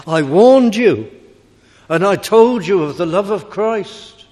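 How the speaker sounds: put-on loud, high, impassioned warning in an old preacher's style; George Whitfield preaching. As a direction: cry it out to a vast open-air crowd. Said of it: "He used to preach like this".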